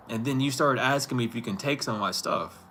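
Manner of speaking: usual speaking voice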